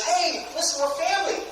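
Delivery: high, insistent mock family pitch; begging. Seller begging family to buy, pleading. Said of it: "I'm begging them because".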